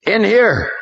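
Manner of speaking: high, loud call from another room. A narrator's reading. Someone calls police from the lounge, loud shout. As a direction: summon the police over. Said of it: "someone shouted".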